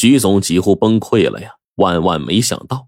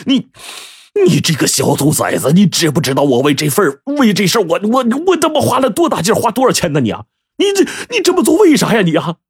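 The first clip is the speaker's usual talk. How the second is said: high, wailing exasperation; nearly crying; 10 semitones higher than usual. A narrator's reading. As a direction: stammer and wail at your son, close to tears. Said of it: "他几乎都快哭了呀". (带着哭腔，又气又急地高声数落)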